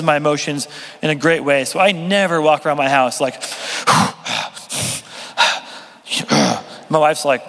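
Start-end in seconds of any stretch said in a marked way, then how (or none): 3.4-5.6 repeated mock-angry huffs; heavy, exaggerated angry breaths; angry breathing. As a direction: huff and puff like you're fuming. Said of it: "why are you breathing like that".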